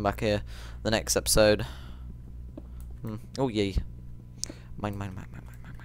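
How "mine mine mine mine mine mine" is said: greedy whispered chant. Real, not acted. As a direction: whisper it gleefully as you dig.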